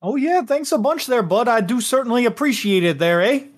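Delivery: put-on Canadian accent. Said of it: "I can only imagine Ricky with that accent".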